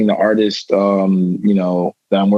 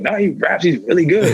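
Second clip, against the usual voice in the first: quoting people; 5 semitones higher than usual. Retold or imitated. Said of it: "But, like".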